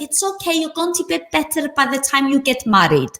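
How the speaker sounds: put-on grandmother's thick Greek accent, high and soothing. Greek grandmother soothing her grandchild, in a thick Greek accent. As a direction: imitate a Greek granny comforting a scraped knee. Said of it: "In this super thick Greek accent".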